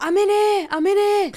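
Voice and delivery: high, loud mock cry for help; imitated distressed call. Imitating a teammate calling from the bathroom, mock-distressed shout. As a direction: call out urgently, hammed-up and high.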